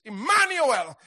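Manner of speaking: ordinary talk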